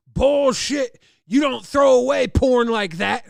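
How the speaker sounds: loud, high furious rebuttal; dad shouting down the staff; mock yelling dad, full blast; angry shout. Dad yelling at teen center.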